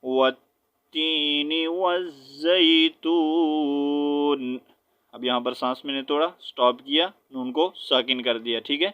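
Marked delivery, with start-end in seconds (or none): 0.0-4.6 slow recited tajweed, breath break after وَالزَّيْتُونِ; proper stop recitation. Shown as a demonstration. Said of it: "سانس توڑیں گے proper طریقے سے". (صحیح طریقے سے رک کر تلاوت)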